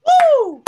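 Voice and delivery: high, loud party whoop; loud excited show-opening whoop; hype whoop. Acted. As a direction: whoop to pump up the crowd. Host cheers the guest.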